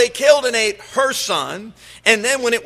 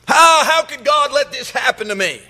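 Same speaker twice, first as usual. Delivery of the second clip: indignant complaint; 6 semitones higher, 4 dB louder than usual. Acted. Preacher voices complaining person.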